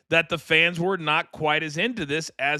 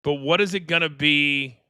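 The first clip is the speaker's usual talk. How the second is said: usual speaking voice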